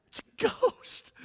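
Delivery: high, unvoiced scared whimper; breathless frightened gasp. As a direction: gasp it out in terror. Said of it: "screaming like little third grade girls".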